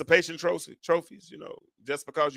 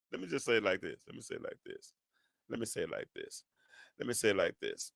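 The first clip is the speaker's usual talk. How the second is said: very soft and low, almost whispered; hushed preaching aside; 8 semitones lower, 14 dB quieter, faster than usual. Real, not acted. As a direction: drop right down, repeating it in a hush. Preacher drops voice before point.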